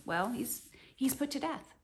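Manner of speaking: normal talking voice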